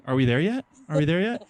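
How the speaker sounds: lightly voiced impatient kids, rising questions; a hint of nagging child; kids on a trip. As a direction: pester the parents, ending each question on a rise. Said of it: "and the kids were like".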